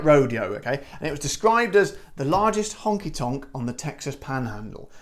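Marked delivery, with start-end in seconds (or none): 2.2-4.9 quiet, understated British accent. Shown as a demonstration. Host shows how ridiculous it sounds, plain British accent. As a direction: say it in a low-key British accent, trailing off. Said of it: "sounds ridiculous in a British accent".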